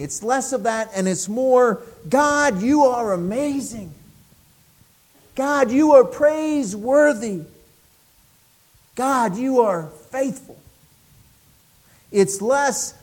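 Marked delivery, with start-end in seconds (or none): 2.1-10.6 lifted high, ringing praise to God; exalted praise. Pretend. Preacher voicing worshipper's praise to God, lifted high. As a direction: cry it out in adoration.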